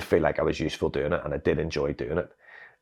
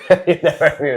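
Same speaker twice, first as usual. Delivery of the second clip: laughing; 12 semitones higher, 10 dB louder than usual. Real, not acted. Laughing at own old age.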